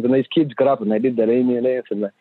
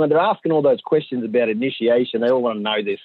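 normal talking voice

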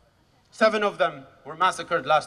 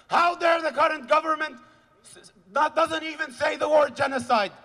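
high, shouted righteous outrage; furious denunciation; 6 semitones higher than usual. Real, not acted. Rally speaker rebuking government and Penny Wong, furious shouting. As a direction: rail at them, voice raised and pushed.

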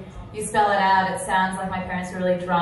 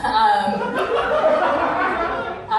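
laugh; 8 semitones higher, 6 dB louder than usual. Real, not acted. Laughing at own joke.

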